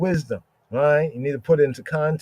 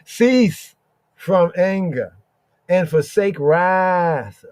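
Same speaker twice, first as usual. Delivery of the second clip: slow scripture reading; 4 semitones higher, 3 dB louder, much slower than usual. Acted.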